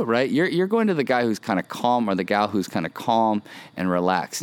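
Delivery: ordinary talk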